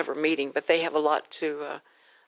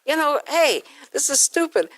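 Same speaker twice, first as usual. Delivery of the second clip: higher, exasperated retold objection; a hint of an outraged band; mock indignant protest; 8 semitones higher than usual. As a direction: play the fed-up band member.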